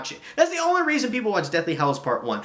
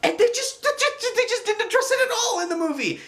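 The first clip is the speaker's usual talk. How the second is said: much higher, exasperated complaint; exasperated rant. Real, not acted. Reviewer ranting at the movie's omission, high exasperated pitch. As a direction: squeak it out in frustration.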